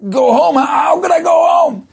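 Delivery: high, loud cry of disbelief; disbelieving outcry. Acted. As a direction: shout it as if the advice is madness. Chassid shocked at Rebbe's advice.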